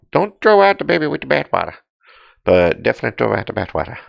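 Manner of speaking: high, goofy put-on comedian voice; Adam Sandler voice. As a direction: ham up a silly celebrity impression, pitched well up. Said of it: "What does Adam Sandler have to do with this?".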